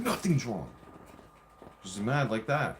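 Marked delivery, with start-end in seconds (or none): 0.0-0.7 mildly acted irritable snap; retold curt denial; snapping. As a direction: imitate a partner's testy brush-off. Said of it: "snapping at me".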